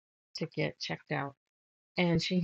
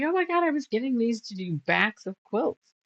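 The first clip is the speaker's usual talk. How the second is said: shocked; 5 semitones higher, 5 dB louder than usual. Retold or imitated. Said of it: "she was all shocked".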